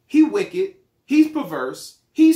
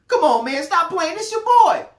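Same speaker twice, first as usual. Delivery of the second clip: much higher and louder, fake-friendly phone greeting; overeager cousin; 9 semitones higher, 6 dB louder than usual. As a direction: gush like a long-lost cousin after money. Acted phone call, cousin caller.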